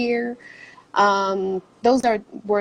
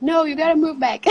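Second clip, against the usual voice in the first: higher, quick imitation of mom; insisting mom; 6 semitones higher, faster than usual. Daughter retelling mom calling her home, mock insistent. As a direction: play a mother laying down the law, lightly. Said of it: "my mom's like".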